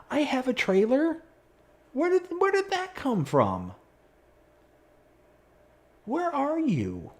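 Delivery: very high, incredulous questions; baffled surprise. Real, not acted. Gamer finding a mystery trailer, squeaking in disbelief. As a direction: squeak out your confusion.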